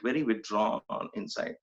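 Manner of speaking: ordinary talk, nothing marked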